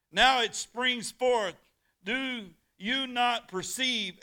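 loud, high, slow scripture proclamation; full-force preaching. Acted. Preacher proclaiming Isaiah to the congregation, loud and slow. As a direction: thunder the verse out to the church.

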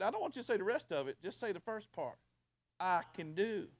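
ordinary talk

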